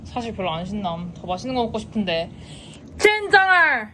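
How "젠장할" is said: frustrated curse. Real, not acted. Frustrated about bland meal.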